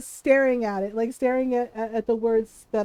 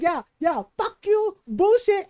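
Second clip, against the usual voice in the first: mom's retort; 5 semitones higher, 4 dB louder than usual. Retold or imitated. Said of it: "She's like".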